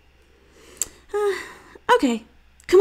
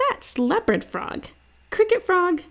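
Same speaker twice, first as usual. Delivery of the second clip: put-on eager boy's voice, pitched up; a kid blurting out guesses to his sister; young boy guessing; 9 semitones higher than usual. A narrator's reading. Boy guessing frog names for his sister, eager and high.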